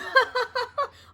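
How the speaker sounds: loud high mocking burst of laughter. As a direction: cackle at your brother's drawing. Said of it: "Leon burst out laughing".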